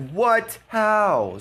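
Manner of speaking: hammed-up confused outrage; mock surprise. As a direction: imitate the opponent's disbelief. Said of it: "He's like".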